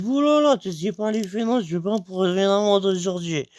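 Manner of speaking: high, alarmed inner voice; a jump up in pitch, worried; panicked thought. Retold or imitated. Thinking to himself, afraid of a fine, alarmed.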